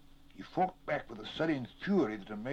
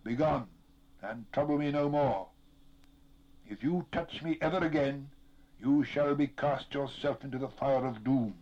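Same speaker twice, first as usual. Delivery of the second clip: raised, ringing, stern command; high, forceful voice of authority; 4 semitones higher, 5 dB louder than usual. A narrator's reading. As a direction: pronounce a doom on the intruder. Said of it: "there spoke a commanding voice".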